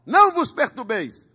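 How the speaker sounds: Paul's reassurance. Acted. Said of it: "diz pros irmãos assim".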